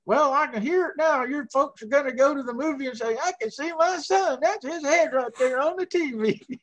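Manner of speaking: mock Southern accent. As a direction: send up a Georgia accent for fun. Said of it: "He's making fun of my accent".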